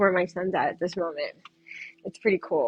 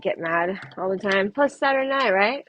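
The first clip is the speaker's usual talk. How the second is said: usual speaking voice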